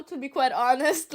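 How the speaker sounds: normal talking voice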